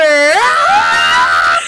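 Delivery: a loud, high cry of shock; shocked shout. Retold or imitated. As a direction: yell it out in horror. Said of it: "realises, shouts".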